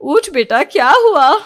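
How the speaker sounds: urgent motherly wake-up call; concerned mother shaking her child awake; worried mother. A narrator's reading. Mother wakes screaming daughter from nightmare.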